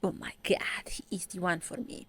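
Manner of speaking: whispered breathless gushing; mock swooning girl. Mocking the heroine swooning over her ex, whispered. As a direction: whisper it like a smitten fan. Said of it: "she's like".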